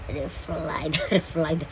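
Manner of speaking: ordinary delivery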